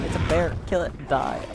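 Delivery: high, loud panicked shout at a bear; panicked yelling. Retold or imitated. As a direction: yell it like you're suddenly panicking mid-fight. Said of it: "I was going".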